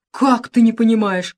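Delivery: amazed he doesn't get it; surprised. A narrator's reading. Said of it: "удивилась королева".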